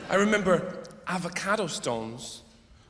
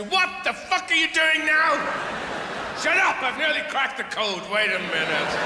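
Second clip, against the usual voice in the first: shouting at dad, then snapping back; a mock-furious family yell; exasperated family shout; 7 semitones higher than usual. Family yells at dad hunting for keys, exasperated shout.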